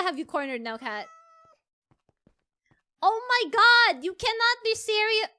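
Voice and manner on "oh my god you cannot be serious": loud, raised exasperated cry; exasperated disbelief. Real, not acted. Player fed up with the runaway cat, exasperated. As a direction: draw it out loudly, incredulous.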